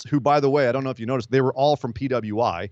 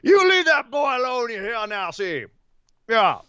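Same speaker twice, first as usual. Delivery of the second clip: mock Southern accent; 8 semitones higher than usual. Continuing mock Southern bit.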